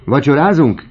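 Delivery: high, loud excited-sounding call; shouting. A narrator's reading. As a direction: shout it out as a question. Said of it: "kiáltotta Horace Bianchon".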